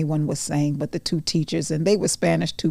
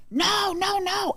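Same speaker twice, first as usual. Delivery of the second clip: much higher, shrill-pitched no no no; gym owner screaming; 14 semitones higher, slower than usual. Retold or imitated. Gym owner yelling across the gym, high screaming no. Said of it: "who he was screaming to".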